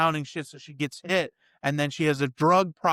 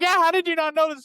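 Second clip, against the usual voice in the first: much higher, louder and quick, mocking disbelief; daughter's incredulous voice; 13 semitones higher, 5 dB louder, faster than usual. The daughter asking her father how he missed it, high and mocking. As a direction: squeal it like a teen who can't believe him. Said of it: "And she's like".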